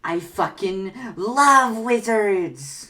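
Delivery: loud, drawn-out proclamation; emphatic declaration. Acted. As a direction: belt it out slowly, stretching the big word. Emphatic answer to wizard question.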